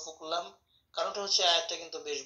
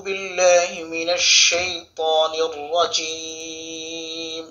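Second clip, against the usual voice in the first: slow, loud, drawn-out tilawah chant; Quran recitation; 13 dB louder, much slower than usual. Acted. Teacher opens the Quran lesson, slow loud recitation. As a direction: chant each word slowly and fully.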